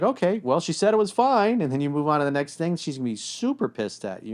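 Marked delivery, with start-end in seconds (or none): 0.0-1.6 higher, louder oblivious shrug; oblivious guy. Retold or imitated. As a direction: play the clueless guy. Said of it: "if you're just like".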